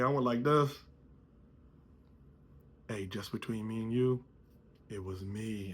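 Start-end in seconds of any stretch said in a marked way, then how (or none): 2.9-5.7 secret confession. Retold or imitated. Confessing secretly to old schoolmates.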